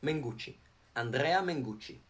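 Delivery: "Mengucci" said as Men plus Gucci; Italian name "Mengucci", softly demonstrated; pronunciation demo. Shown as a demonstration. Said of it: "how do you pronounce Mengucci?".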